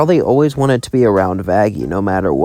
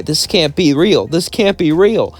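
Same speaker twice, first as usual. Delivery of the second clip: mild crying; 7 semitones higher, 4 dB louder than usual. Acted. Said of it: "as she started to cry".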